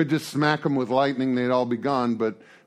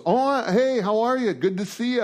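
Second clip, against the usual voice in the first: cheery Saul; 8 semitones higher than usual. Acted. Saul greets Samuel cheerily.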